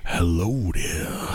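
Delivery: low, slow monster truck rumble; slow booming monster truck voice. Acted. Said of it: "my monster truck voice".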